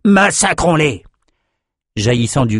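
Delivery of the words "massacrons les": high, drawn-out war cry; slow, high rallying shout; battle cry. Acted. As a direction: rouse fighters to attack. Soldier urges slaughter of enemies.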